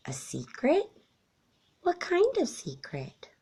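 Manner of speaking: put-on hushed, high wondering child voice; a small child asking in a near whisper; curious little raccoon. A narrator's reading. Child raccoon asks his mother, hushed and wondering.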